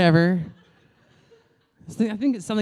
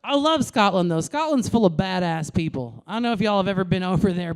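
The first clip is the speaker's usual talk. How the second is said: ordinary talk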